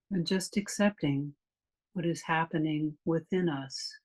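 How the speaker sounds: calm meditation voice with long pauses; guided meditation. Real, not acted. Meditation teacher leading a class through a body scan, calm guided meditation. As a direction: guide them unhurriedly, leaving long silences between phrases.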